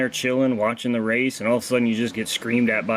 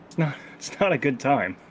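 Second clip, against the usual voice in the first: laughing; 4 semitones higher than usual. Real, not acted. Joking about bear bond pain.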